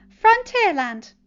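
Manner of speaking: high, loud, slow and final; decisive Peter. A narrator's reading. Peter picks the ride, high slow final answer. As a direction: settle it in one word. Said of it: "Peter said with finality".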